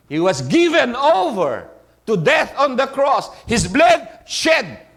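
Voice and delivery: loud, high preaching cry; full-force shout about the cross. Real, not acted. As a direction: build into a fervent shout. Preacher builds to the climax.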